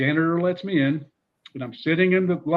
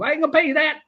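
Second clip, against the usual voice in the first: much higher, flat refusal; Spurrier impression; 12 semitones higher, 4 dB louder, faster than usual. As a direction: turn him down cheerfully, pitched up. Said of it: "He says".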